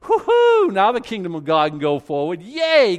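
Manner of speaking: whooping high and loud, a hammed-up celebration; mock cheering God. God mock-celebrates a pitiful little check, whooping loudly. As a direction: overblown party cheer, mocking the idea. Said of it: "and he's like".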